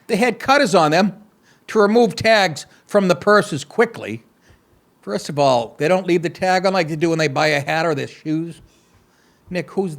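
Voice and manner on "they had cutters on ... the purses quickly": mock anchor reading the story; deadpan read of the news copy. Host reading the heist story to listeners, mock news read.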